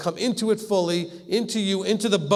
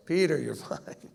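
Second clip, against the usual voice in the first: softer, chuckling through the words; amused reassurance; 5 semitones lower, 6 dB quieter than usual. Retold or imitated. Lord chuckling at a frightened Peter, amused reassurance. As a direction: laugh him out of his fear. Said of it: "having that attitude".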